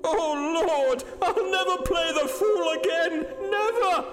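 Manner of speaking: very high, sobbing outcry; hysterical. A narrator's reading. Hurst swearing off playing the fool, hysterical. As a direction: wail it in terrified hysteria. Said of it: "with a hysterical sob".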